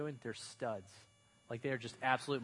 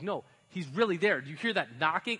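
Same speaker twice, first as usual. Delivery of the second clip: insisting; 3 semitones higher, 6 dB louder than usual. Retold or imitated. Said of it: "she's like".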